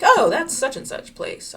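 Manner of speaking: high, loud, slow mock recognition; know-it-all local. Mocking a GeoGuessr pro naming the statue, loud and slow. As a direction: ham up an expert who knows the spot at once. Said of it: "and they're like".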